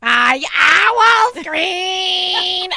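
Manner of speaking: put-on high, very slow, loud kid's shout; child yelling. Little son shouting to his parents at dawn, drawn-out yell. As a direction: stretch it out like a toddler yelling at dawn. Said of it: "at six in the morning".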